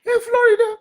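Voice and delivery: sobbing, voice pitched high; real crying. Real, not acted. Speaker telling listeners her picture was on the banner, crying. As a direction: break down in tears while talking. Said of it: "why I'm crying over that banner".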